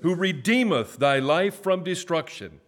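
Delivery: high, loud proclaiming of scripture; preaching. Acted. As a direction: call out each verse for the church to echo. Leads responsive scripture reading.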